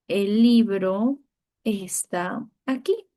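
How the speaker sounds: slow, drawn-out teacher dictation. Shown as a demonstration. Teacher dictating the sentence slowly to pupils. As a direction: stretch each word so pupils can copy. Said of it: "la palabra aquí, tilde".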